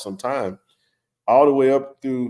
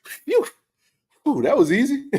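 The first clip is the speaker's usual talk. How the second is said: relieved phew; 14 semitones higher than usual. Retold or imitated. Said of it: "I was like".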